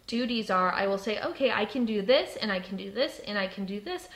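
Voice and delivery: ordinary delivery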